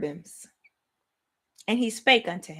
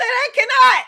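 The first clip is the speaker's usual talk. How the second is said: overwhelmed exclamation; 8 semitones higher, 8 dB louder than usual. Retold or imitated. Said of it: "I said".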